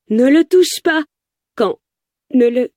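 high-pitched panicked cry; desperate yell. A narrator's reading. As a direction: cry out high to stop the shot. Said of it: "J'hurle à en crever". (cri aigu de panique)

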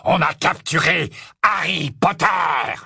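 loud, slow victorious shout; triumphant shout. Acted. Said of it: "rugit Greyback d'une voix triomphante".

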